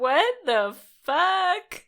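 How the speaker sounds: high, slow, loud disbelief; shocked. Real, not acted. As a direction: stretch it out in shock. Shocked at height answer.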